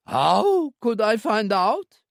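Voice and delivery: frightened protest. A narrator's reading. Owner objects to locking Marcel in, high frightened voice. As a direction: protest in fear.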